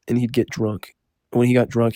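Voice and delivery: ordinary talk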